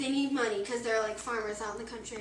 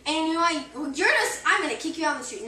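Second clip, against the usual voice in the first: loud joking rant, laughing through it; fake-yell at the wife while cracking up; mock angry husband; 4 semitones higher, 10 dB louder than usual. Kid retelling a story, mock husband yelling at his wife, laughing. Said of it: "No, I'm just kidding".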